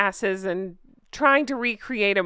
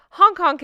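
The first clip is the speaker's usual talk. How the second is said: loud high goose honk; 9 semitones higher, 8 dB louder than usual. Pretend. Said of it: "I honk because one of Megan's followers told me I sound like a drunk goose".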